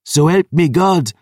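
loud, high threatening shout; furious shout. Acted. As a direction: bellow the oath. Historian's threat.

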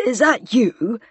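high-pitched anxious questioning; hoarse, anxious. A narrator's reading. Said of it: "in a hoarse, anxious voice".

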